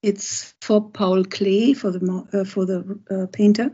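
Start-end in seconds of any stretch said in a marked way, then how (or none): none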